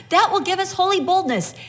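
ordinary delivery

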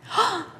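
sudden high gasp of discovery; sharp gasp. Acted. Preacher acting out a believer's sudden realisation with a gasp. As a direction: gasp as if spotting a chance.

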